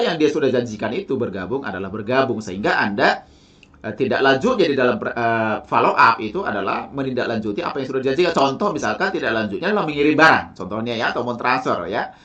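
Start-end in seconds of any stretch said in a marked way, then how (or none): none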